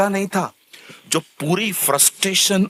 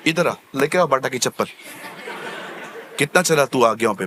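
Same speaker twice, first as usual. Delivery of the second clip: mock stern God; 5 semitones lower than usual. God scolding with a slipper.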